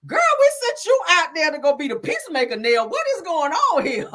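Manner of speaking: high-pitched mock exasperation; acted scolding. Sonny scolding Nell the peacemaker, high mock exasperation. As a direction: play an incredulous friend, drawing out the question.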